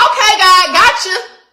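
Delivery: the sudden joy of getting it; excited realization. Retold or imitated. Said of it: "I said".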